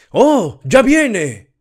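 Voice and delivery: very high, loud ecstatic shriek; a frenzied woman screaming that it comes. A narrator's reading. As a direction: shriek it at the top of the voice. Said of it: "chilló Clara Detterding".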